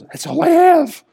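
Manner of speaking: high, loud lady voice; lady's voice. Retold or imitated. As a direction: squeak it out as the lady. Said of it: "She goes".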